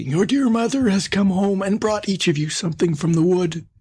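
high put-on mother voice; wolf posing as mother. A narrator's reading. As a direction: coax the children as the disguised wolf. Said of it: "cried he".

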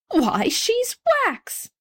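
very high, drawn-out amazement; astonished exclamation. A narrator's reading. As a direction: exclaim slowly, swooping up in astonishment. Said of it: "middle-aged gentleman now exclaimed".

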